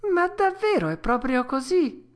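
high, loud tearful puppet voice; mildly sobbing. A narrator's reading. Pinocchio asking if it's true, high and tearful. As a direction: plead like a child close to tears. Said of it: "domandò singhiozzando il burattino".

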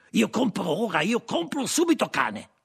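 high, loud, insistent buyer; urgent demand. Acted. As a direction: insist loudly on buying it right now. Frenchman demands to buy dog. (richiesta concitata, acuta e forte)

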